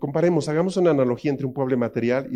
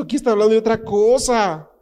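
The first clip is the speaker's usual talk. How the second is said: ordinary talk, nothing marked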